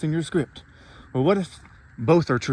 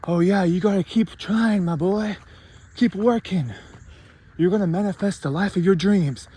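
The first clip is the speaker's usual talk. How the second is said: pitched up, syrupy pep-talk voice; a higher, mock-sweet motivational speaker; mocking motivational coach; 6 semitones higher than usual. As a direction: parody a cheesy life coach cheering you on. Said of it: "Not like those others who say".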